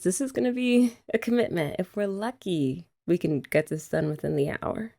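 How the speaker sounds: ordinary delivery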